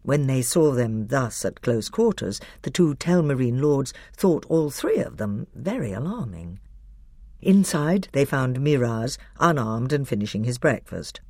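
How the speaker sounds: ordinary delivery, nothing marked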